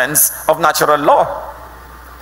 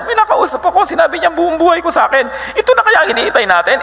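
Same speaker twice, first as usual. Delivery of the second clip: excited; faster than usual. Acted. Woman tells the townspeople excitedly.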